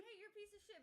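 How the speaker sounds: high, laughing taunt. Retold or imitated. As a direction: laugh through a cheeky insult. Santa voiced, laughing.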